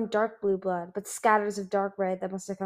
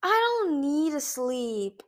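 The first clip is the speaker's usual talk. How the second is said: slow, higher, strange strained voice; strained defiance; 6 semitones higher, much slower than usual. Acted. Exhausted Icicle insisting she needs no sleep, strained. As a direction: drag the words out, exhausted but defiant.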